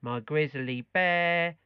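put-on beer in drunk on bear said as bear; slow drill, Norfolk vowel, bear for beer; norfolk accent. Shown as a demonstration. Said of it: "Try saying".

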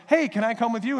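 high, loud eager asking; eager follower. Retold or imitated. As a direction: pastor voicing the hopeful man asking Jesus, high eager plea. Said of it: "this one guy goes".

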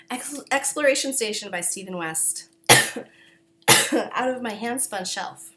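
usual speaking voice

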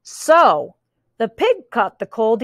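plain reading voice

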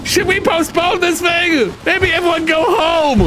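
high anxious character voice; worried sim voice. Acted. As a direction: play a nervous sim begging to leave. Voicing sim during lightning storm.

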